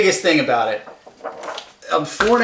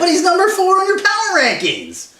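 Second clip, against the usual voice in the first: incredulous; 5 dB louder than usual. Acted. Incredulous comeback in argument.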